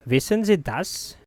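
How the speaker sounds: normal talking voice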